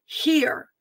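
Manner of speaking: "hear" set apart, high and falling; stress on hear. Real, not acted. Calling those with ears to listen, "hear" stressed. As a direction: proclaim "hear" as a one-word command. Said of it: "That's capitalized".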